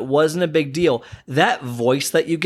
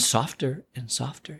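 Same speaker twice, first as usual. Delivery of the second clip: "softer and softer" dropping to near silence; fading softer; 9 dB quieter than usual. Shown as a demonstration. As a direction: let "softer and softer" fade away to almost nothing. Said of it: "See what I did there?".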